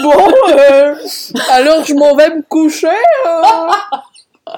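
real laugh breaking into the line. Real, not acted. Joking she is off to bed, laughing hard.

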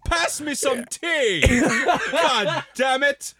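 high, slow hammed-up British voice, laughing through it; laughing mock Brit demanding tea; British accent through laughter. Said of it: "accent britannique".

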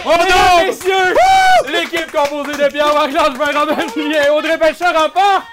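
loud, high game show announcement; announcer shout. Real, not acted. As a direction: belt out the winners like a host. Host announces winning team.

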